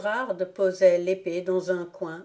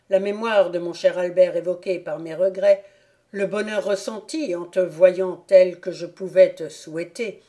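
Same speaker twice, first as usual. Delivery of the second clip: a commanding count, louder and firm; stern proud father; 5 dB louder than usual. Acted. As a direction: announce his fortune with paternal pride. Count continues his speech.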